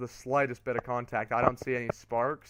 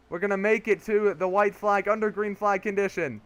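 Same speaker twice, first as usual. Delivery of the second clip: raised, excited announcer voice, stretched phrases; race commentary; 6 semitones higher, 5 dB louder than usual. Real, not acted. Commentator calling Briscoe and Larson to the finish, excited play-by-play. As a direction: build the drama of the final lap. Live final-lap race call.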